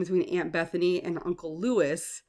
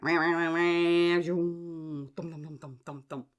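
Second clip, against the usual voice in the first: imitated chainsaw. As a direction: mimic a saw revving, then wood tumbling. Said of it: "So he went".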